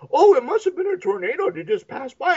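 high, airy mock-clueless girl; clueless daughter. Daughter shrugging off the killer tree, high mock airhead. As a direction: play the ditzy daughter, blissfully unaware. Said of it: "She comes out and she's like".

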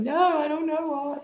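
high, teary voice imitating her younger self; a touch of remembered sobbing, pitched high; retold crying. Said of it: "And I was crying".

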